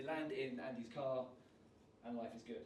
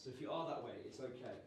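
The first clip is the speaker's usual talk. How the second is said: ordinary delivery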